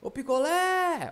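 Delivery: "picolé" hollered high with a long "lé"; calling out. Shown as a demonstration. Teacher shows calling the word, calling out. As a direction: call "picolé" like someone far away, stretching the end. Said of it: "você chamando a palavra".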